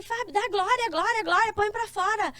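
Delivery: replayed coaxing to let it out; retold urging. Urging Fábio in a retold exchange.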